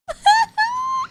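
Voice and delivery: high-pitched imitated crying squeal; mock wail. Acting out how I was at that moment, high mock wail. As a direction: squeal as if bursting into tears. Acts out own reaction to scene.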